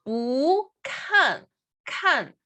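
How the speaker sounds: "bu" rising, "kan" falling sharply; fourth tone on kan. Shown as a demonstration. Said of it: "igual el cuarto tono".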